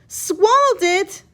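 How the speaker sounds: high, loud, slow alarmed question; alarmed cry. A narrator's reading. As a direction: gasp it out in disbelief. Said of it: "Maria cried".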